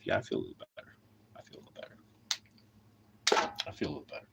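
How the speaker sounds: breathy whisper, trailing off. Real, not acted. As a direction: whisper it to yourself, spent. Repeats softly after rant.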